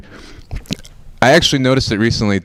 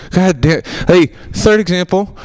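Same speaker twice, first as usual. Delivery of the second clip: ordinary delivery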